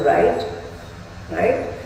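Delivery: usual speaking voice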